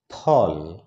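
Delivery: ফল with both lips pressed, a puff; ফল with a bilabial burst; Bengali ph. Shown as a demonstration. Teacher gives students the correct word for fruit. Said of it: "আমরা বলবো".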